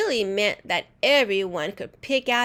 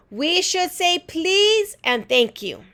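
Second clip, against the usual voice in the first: put-on child voice; 9 semitones higher, 5 dB louder than usual. A narrator's reading. Child answers teacher.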